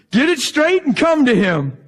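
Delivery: loud, high hammed-up order; mock stern command. Parodying counsel given to sinners, mock scolding. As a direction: call out the bad advice like a scold. Mocks bad counsel before correcting it.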